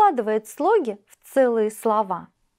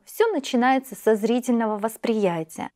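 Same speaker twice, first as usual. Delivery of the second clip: ordinary delivery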